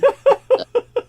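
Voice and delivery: high, loud burst of laughter; cackling burst of laughter. Real, not acted. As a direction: crack up loudly.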